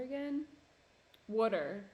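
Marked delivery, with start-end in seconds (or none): 1.3-1.8 "Water" said loud as WOOD-er; South Jersey pronunciation. Retold or imitated. Imitating friends from South Jersey, "Water" as WOOD-er. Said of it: "They say water".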